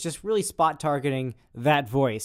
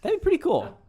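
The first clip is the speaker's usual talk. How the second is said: high squeaky voice. Real, not acted. Playful high reply.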